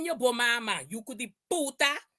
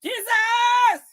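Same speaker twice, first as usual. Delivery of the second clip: high, loud cry of Jesus; loud exclamation; 9 semitones higher, 5 dB louder than usual. Real, not acted. Streamer exclaims Jesus at the peak of her rant. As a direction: throw it up to the sky.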